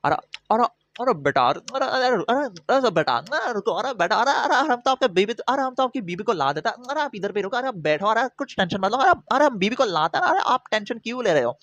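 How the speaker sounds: high, fast babbling Hindi cop impression; mock cop. Cop soothing the husband, mock high babble. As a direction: rattle off the ara ara like a fussy cop. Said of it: "he's like".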